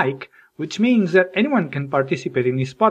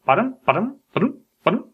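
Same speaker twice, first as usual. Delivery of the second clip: "pardon" squashed short and fired off, higher; very fast pardon; 6 semitones higher, faster than usual. Retold or imitated. Mimicking English people saying pardon so fast, high and rushed. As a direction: fire off pardon in quick, high bursts. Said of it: "say pardon so fast".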